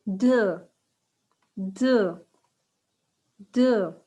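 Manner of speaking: Deux said clearly with a rounded uh vowel; loud teacher model of Deux; model pronunciation. Shown as a demonstration. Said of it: "Comment on dit two".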